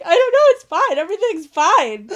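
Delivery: high, loud, tearful laughing denial; laugh-crying. Retold or imitated. Reader crying over a book, tearful high laughing denial. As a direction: insist you are fine while sobbing with laughter.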